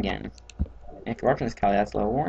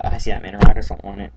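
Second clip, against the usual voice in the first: mocking; 12 dB louder, faster than usual. Mocking friend's attitude.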